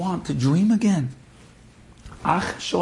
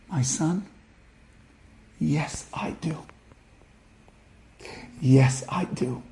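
whispered, slow and choked up; a mother whispering through tears; tearful whisper; 6 dB quieter than usual. Retold or imitated. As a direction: breathe the words out, overcome. Mother answers son, overcome.

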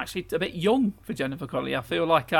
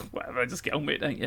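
ordinary talk, nothing marked

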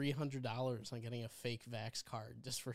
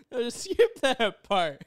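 laughing; 9 semitones higher, 11 dB louder, slower than usual. Real, not acted. Laughing after censored remark.

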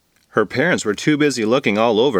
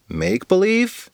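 "Make-believe" rising high as a question; puzzled question; 8 semitones higher than usual. Acted. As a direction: echo "Make-believe" uncertainly, pitch climbing at the end. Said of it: "questioned Rose".

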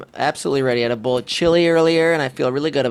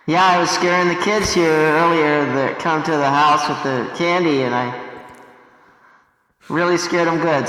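muffled through mask. Real, not acted. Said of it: "you can hear for yourself that he is, uh, spooked up".

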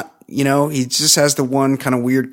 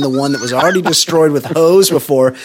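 usual speaking voice

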